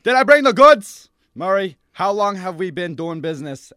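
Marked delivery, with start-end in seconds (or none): 0.0-1.1 mock-offended echo. Dealer echoes question, offended.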